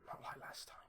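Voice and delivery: a quick sly whisper; whispered aside. Acted. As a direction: let it slip under your breath. Sly aside after a joke.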